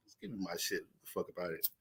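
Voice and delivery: a low, grudging mumble taking the diploma; sulky graduate mumble. Retold or imitated. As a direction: grumble it under your breath like a sullen graduate. Voicing an angry graduate.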